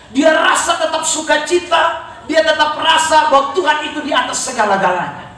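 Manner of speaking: high, forceful preacher's shout; full-force preaching. Real, not acted. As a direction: drive it home to the congregation.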